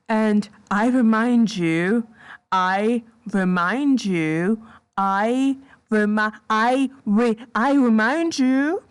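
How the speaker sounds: slow, drawn-out "I remind you", restarted and climbing; insistent repetition. Real, not acted. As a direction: hammer "I remind you", stretching it and rising at the end. Insistent repetition before damning fact.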